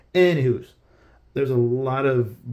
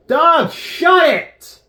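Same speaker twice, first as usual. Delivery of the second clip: high, slow, full-throated yell; yelling at dog; 11 dB louder, much slower than usual. Real, not acted. Streamer finally yells at the barking dog, loud. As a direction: bellow it across the house. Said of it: "I have a loud voice".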